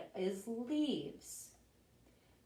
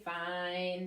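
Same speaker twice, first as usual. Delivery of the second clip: ordinary delivery, nothing marked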